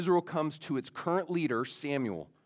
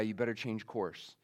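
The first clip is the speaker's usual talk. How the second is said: quiet warning; 5 semitones lower, 7 dB quieter than usual. Retold or imitated. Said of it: "this voice, the voice of God".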